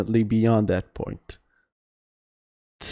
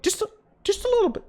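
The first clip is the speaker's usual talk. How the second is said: much higher, fast teasing repeat; playful sing-song; much faster than usual. Real, not acted. Streamer teasing chat about getting into it, high and playful. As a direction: tease chat with it.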